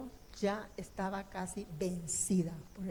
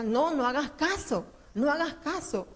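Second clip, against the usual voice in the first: louder and higher, pressing her not to listen; the enemy's voice urging her on, raised; insistent tempting voice; 5 semitones higher, 6 dB louder than usual. Retold or imitated. The tempting voice urges the praying mother, raised and insistent. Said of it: "Y el otro me decía".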